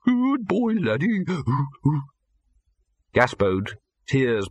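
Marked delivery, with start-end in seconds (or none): 0.0-1.2 calling a dog, high and hopeful; distant high calling voice. Acted. Troll in the rubble calls the dog, high. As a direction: call out to the dog in the dark.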